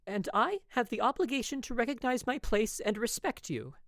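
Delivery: put-on young wife nervously insisting on propriety. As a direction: push back with anxious force. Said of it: "I nervously raised my voice".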